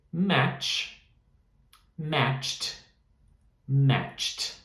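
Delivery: "matched" ending in a crisp, audible t; stressed final t. Shown as a demonstration. Teacher drilling the past tense ending, emphasized t. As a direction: land hard on the final t of "matched". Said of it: "eu tô enfatizando pra que vocês entendam o som no final".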